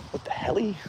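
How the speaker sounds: high surprised. Real, not acted. Police heading to his flags.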